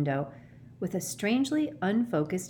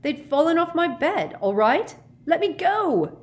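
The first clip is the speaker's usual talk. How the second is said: high, loud protest building to a demand; frantic, indignant yelling; angry shout; 11 semitones higher, 6 dB louder than usual. A narrator's reading. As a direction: yell to be let go. Ron shouting to be freed.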